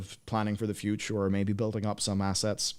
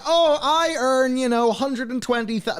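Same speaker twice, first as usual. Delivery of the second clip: mock American accent; 5 dB louder than usual. Mocking a New York earner on millennial money, high American accent. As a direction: ham up a smug American boast, pitched high. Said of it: "I don't know what accent this is".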